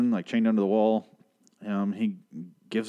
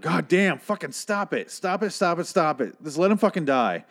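exasperated plea; 7 semitones higher than usual. Retold or imitated. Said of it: "And I was like".

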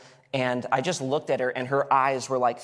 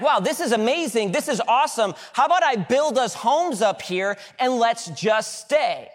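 high, loud, awestruck Peter wanting to stay; excited Peter; 8 semitones higher, 5 dB louder than usual. Retold or imitated. Preacher voicing Peter on the mountain, high excited gushing. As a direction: gush like a thrilled disciple who never wants to leave. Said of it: "He, he's like".

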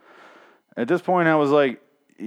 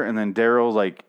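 ordinary talk

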